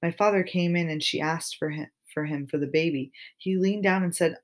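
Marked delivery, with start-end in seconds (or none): none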